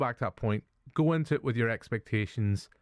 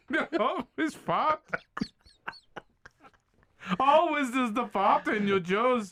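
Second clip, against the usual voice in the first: mock Hitler; 13 semitones higher, slower than usual. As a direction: ham up a cartoonish dictator.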